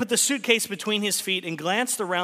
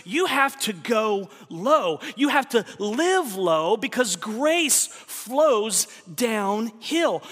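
preaching at full force; 6 semitones higher, slower than usual. Acted. Preacher to his congregation, building to full force. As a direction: drive the point home from the pulpit.

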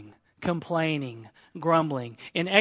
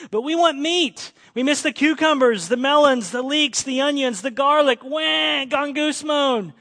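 high, loud mock whining; hammed-up complaining about food; whiny Israelites; 6 semitones higher, 6 dB louder than usual. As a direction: Israelites moaning to God for meat, whiny. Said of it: "They said".

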